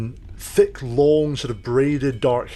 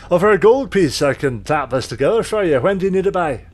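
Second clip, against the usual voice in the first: much higher, louder dwarf woman voice; dwarven smith; 6 semitones higher, 4 dB louder than usual. Acted. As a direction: greet the customer as a loud dwarven smith.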